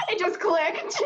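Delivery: high bursts of laughter; laughing through the words. Real, not acted. Laughing at accent slip.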